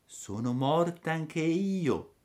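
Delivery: put-on high, slow ghostly girl; otherworldly little voice. A narrator's reading. Dead girl answers the puppet, eerie little voice. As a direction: float it out slowly, from beyond the grave. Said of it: "con una vocina che pareva venisse dall'altro mondo".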